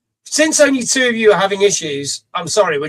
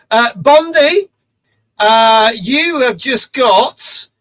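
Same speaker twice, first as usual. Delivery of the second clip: hype announcement; 5 semitones higher, 6 dB louder, much slower than usual. Real, not acted. Announcing a viewer reward.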